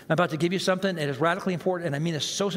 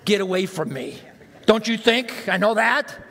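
inner irritated retort. Retold or imitated. Preacher voices the inner reply to a well-meaning friend, exasperated. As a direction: let the inner annoyance out. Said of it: "what are you saying on the inside".

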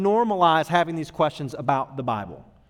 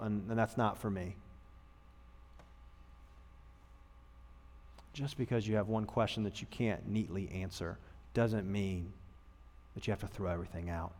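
hushed sermon; 6 semitones lower, 13 dB quieter than usual. Real, not acted. Sermon drops to hush.

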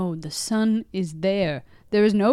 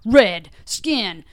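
slow mock announcement; 3 semitones higher, 5 dB louder, much slower than usual. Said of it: "then it's like".

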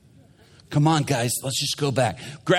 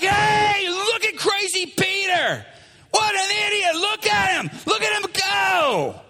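high, hollered mockery; jeering crowd; 12 semitones higher than usual. Crews jeering crazy Peter from shore, mocking. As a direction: jeer at him in a sing-out high voice. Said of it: "And they're like".

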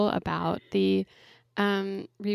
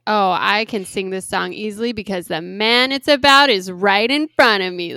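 loud mock-snarky singer; exaggerated snark, pushed out loud; 8 dB louder than usual. Host mimics singer's jabs about an ex, snarky. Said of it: "like, her snarky".

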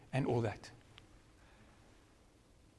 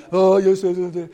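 loud, higher-pitched exasperated groan of complaint; mock grumbling; 5 semitones higher, 9 dB louder than usual. Preacher acting out griping about those who curse him, mock grumbling. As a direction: grumble loudly, as if fed up. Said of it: "I grumble and say".

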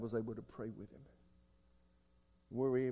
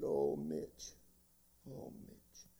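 whispered, broken imitation of a weeping man; imitating a weeping man; 3 dB quieter, much slower than usual. Pastor retelling a distraught visitor, whispered weeping. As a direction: breathe his name out like a man in despair. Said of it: "He was just weeping and crying".

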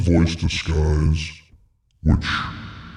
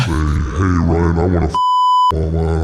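censored speech; 4 dB louder than usual. Shown as a demonstration. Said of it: "I can also censor".

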